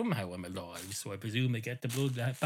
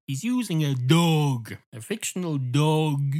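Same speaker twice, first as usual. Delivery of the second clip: louder, slow, every word pressed; indignant emphasis; 4 semitones higher, 9 dB louder than usual. Acted. Dog accusing the narrator to the listeners, loud and slow. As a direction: spell out the accusation slowly.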